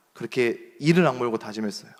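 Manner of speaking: ordinary delivery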